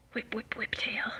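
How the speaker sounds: soft whispered chant. Real, not acted. Owner whispering along as her cat whips its tail.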